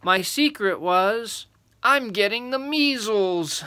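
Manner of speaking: put-on high, drawn-out boy's announcement; gleeful reveal. Little boy revealing his secret, gleeful. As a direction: build to the punchline proudly.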